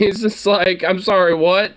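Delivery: high, incredulous I'm sorry, what; baffled. Real, not acted. Streamer failing to understand the man's accent, baffled. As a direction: sound totally lost at his words. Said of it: "having a hard time to understand".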